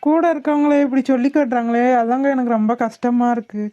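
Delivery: ordinary delivery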